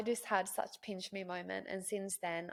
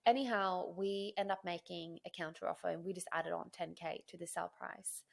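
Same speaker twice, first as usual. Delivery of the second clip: ordinary talk, nothing marked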